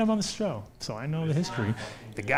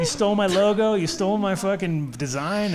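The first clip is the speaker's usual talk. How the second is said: mock outraged inventor; 7 semitones higher, 5 dB louder than usual. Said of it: "And he's like".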